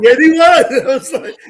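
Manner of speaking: high, loud burst of laughter; laughing. Real, not acted. Agreeing with Chris about the booth, laughing hard. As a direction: break up laughing as you agree.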